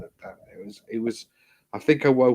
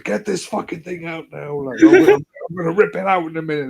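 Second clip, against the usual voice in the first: high, loud furious demand; patient yelling at nurse to remove catheter, high and loud; angry yelling; 10 semitones higher, 7 dB louder than usual. Retold or imitated. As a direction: yell at the nurse to get it out now. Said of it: "I'm going".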